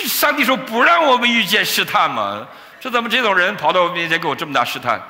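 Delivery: high, loud, hammed-up protest; exasperated complaint. Preacher woken up, complaining of this great test, exasperated. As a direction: wail a comic grievance at high pitch. Acts his panicked complaint to God.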